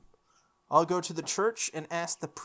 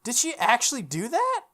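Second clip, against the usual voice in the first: high, loud disbelieving outburst; incredulous gasp; 9 semitones higher, 6 dB louder than usual. Real, not acted. Incredulous reaction to game event.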